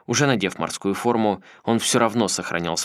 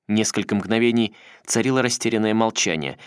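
ordinary delivery, nothing marked

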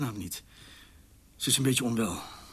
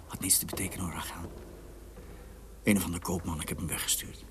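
tender reassurance; 6 semitones lower, 7 dB quieter, faster than usual. Acted. Reassuring wife behind the door.

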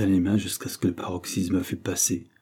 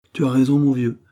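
louder, heartfelt agreement; chaleureux; 5 semitones higher, 7 dB louder than usual. A narrator's reading. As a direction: agree warmly and emphatically with an old friend. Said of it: "dit Henry avec chaleur". (avec chaleur)

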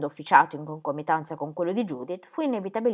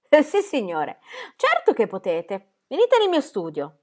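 louder, higher, furious outburst; barely controlled fury; 7 semitones higher, 6 dB louder than usual. A narrator's reading. The bishop furiously answering Herr Dremmel. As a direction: snap at him, losing control. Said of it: "come una furia, con un tono che non riusciva a controllare".

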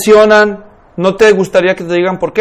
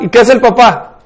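ordinary talk

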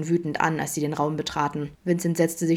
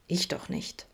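whispered, much softer joking denial; 8 dB quieter, slower than usual. A narrator's reading. Adam denies being jealous, joking whisper. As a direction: breathe the denial as a joke. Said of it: "witzelte Adam".

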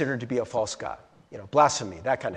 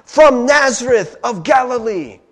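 high, loud, slow proclamation; crowd proclaiming; 8 semitones higher, 8 dB louder than usual. Retold or imitated. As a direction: announce him to the whole city. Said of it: "And the crowd said".

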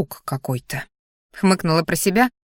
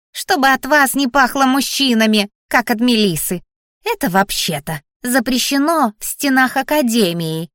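high, hissy creature scolding; angry hissing; 5 semitones higher than usual. A narrator's reading. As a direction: scold them as a cross talking plant. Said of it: "зашипела Кокоша". (сердито шипеть, высоким голосом)

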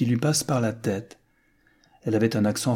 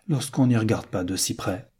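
ordinary delivery, nothing marked

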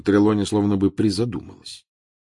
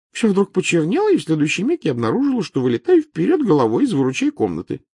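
Trelawney voice; 11 semitones higher, 7 dB louder than usual. Acted.

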